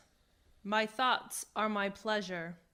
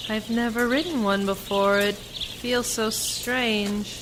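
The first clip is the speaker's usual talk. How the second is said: raised voice; 8 dB louder than usual. Acted. Rider tells the driver over the wind, loud and uneasy.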